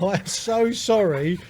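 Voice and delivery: mock-flustered loud sorry; loud apology. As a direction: blurt out an embarrassed sorry. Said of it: "I said".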